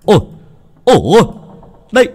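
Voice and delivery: very high, loud excited yells; old dike keeper surfacing and shouting his find. A narrator's reading. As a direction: call out to everyone, you've found him. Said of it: "đoạn hô to".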